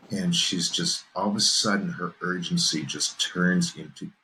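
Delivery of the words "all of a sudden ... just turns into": normal talking voice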